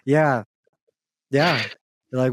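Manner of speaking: usual speaking voice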